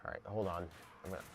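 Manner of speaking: normal talking voice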